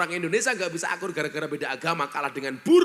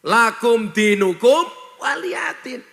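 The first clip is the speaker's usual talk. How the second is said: scripture quote; 4 semitones higher, 8 dB louder, slower than usual. Acted. Quoting scripture slowly.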